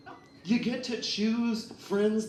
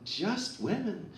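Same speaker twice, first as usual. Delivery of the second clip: mock reassurance; 8 semitones higher, 5 dB louder, slower than usual. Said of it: "I was just like".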